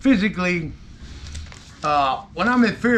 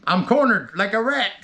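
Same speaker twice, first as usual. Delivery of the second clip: ordinary talk, nothing marked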